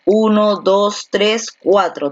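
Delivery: spaced-out count of the syllables; slow counting. Shown as a demonstration. Teacher counts the syllables slowly for the class. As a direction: count slowly, one number at a time.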